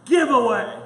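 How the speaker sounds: loud cheer of giveaway; group shout. Pretend. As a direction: shout it out together. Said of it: "We're gonna practice it".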